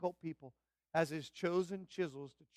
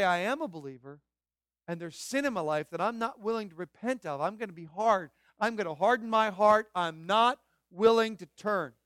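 loud, hammered-out refusal; defiant declaration; 4 semitones higher, 10 dB louder than usual. Acted. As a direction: build to a loud, stubborn preacher's mock vow. Voices a stubborn unrepentant believer.

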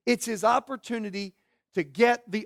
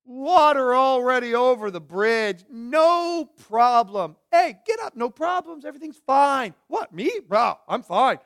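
hammed-up "no offense taken" act; mock nonchalance; 3 semitones higher, 4 dB louder than usual. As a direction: insist far too cheerfully that nothing is wrong. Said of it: "Like most of us do".

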